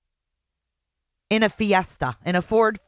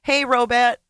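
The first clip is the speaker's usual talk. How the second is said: normal talking voice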